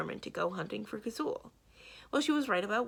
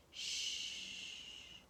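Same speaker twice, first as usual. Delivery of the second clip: much softer hissed shush; 11 dB quieter than usual. A narrator's reading. Samarine silences Mendenbar at the door, hissed shush. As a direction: silence him now. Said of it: "Samarine hissed".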